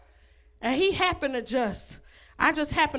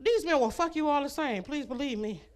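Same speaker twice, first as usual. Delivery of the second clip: normal talking voice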